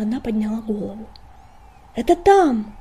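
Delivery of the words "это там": high, loud excited cry; excited exclaim. A narrator's reading. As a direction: cry out with discovery. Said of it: "воскликнула она".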